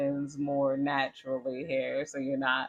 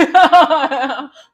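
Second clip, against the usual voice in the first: real cackle, much louder and higher; loud high burst of laughter; 11 semitones higher, 17 dB louder than usual. Real, not acted. Cracking up at a mocking spirit's taunt, loud laugh. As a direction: let a big laugh burst out.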